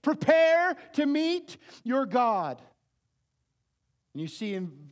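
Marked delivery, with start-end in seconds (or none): 0.0-2.6 slow, loud, high yell of Prepare. Shown as a demonstration. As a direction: shout the warning with full force. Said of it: "because he's yelling it".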